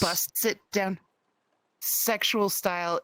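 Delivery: slow, one word at a time; staccato. Shown as a demonstration. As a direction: space each word out. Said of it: "slowly, one word at a time".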